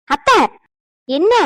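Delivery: high, loud indignant address; indignant protest. Acted. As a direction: call her out in protest. Indignant protest to mother-in-law.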